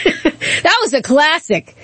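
chuckling through the words, high and slow; laughing. Real, not acted. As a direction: laugh it out as you say it. Host laughing about Clinton's hot sauce.

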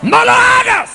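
high shouted warning; urgent angel. Acted. Angel stopping John from kneeling, high urgent shout. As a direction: stop him with a cry.

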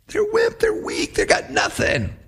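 mock jeering from high to hushed; schoolyard taunt. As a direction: taunt them like a playground bully. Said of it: "Name calling".

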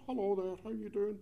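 friendly Scottish hello to a stranger; lovely Scottish accent. Retold or imitated. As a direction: greet him brightly in broad Scottish. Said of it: "I said in my lovely Scottish accent".

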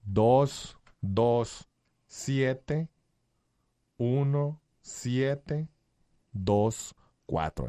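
slow, deliberate digit-by-digit dictation; nice and slowly. Real, not acted. Host repeating the phone number so listeners can write it down, slowly. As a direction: leave long gaps between the number groups. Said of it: "Lo voy a repetir así despacito".

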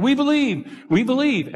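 high, excited crowd chant; eager crowd cheering. Retold or imitated. Crowd at Niagara cheering Blondin on, high and excited. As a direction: cheer it like a thrilled crowd at the falls. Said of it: "he'd work up the crowd and say".

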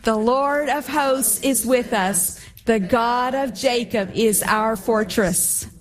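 slow, emphatic group recitation; with conviction. Shown as a demonstration. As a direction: declare it slowly, leading others to join in. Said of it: "with conviction".

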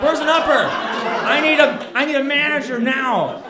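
high, loud demanding shout; hammed-up outraged yelling; irate customer. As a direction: blow up like that guy at the counter. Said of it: "and I become that guy".

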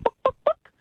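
high-pitched clucked words; clucking chicken. Acted. Chicken asking the librarian at the counter, clucking high. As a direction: cluck the word like a hen. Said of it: "the chicken comes into the library and says".

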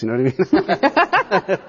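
high, slow, laughing tag to a joke. Real, not acted. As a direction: let the line break up into laughter. Joking about Apaches, laughs.

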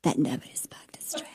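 mock conspiratorial whisper. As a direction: breathe it out like a secret. Whispered aside mocking claim.

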